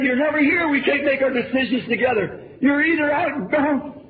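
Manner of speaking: high, sharp scolding wife; snapping back at him, raising her voice; snappy retort. Retold or imitated. Wife snapping at her husband about the children, scolding. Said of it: "said something to me snappy".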